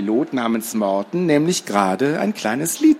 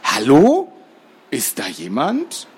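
a hint of a wary pilot; slower than usual. Pilot hears a noise.

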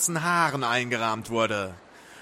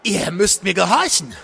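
higher, louder order; called-out command; 5 semitones higher, 5 dB louder than usual. A narrator's reading. Crowley to the summoned being, called-out command. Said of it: "rief er".

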